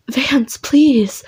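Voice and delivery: a last desperate grab at him; desperate pleading girl. Acted.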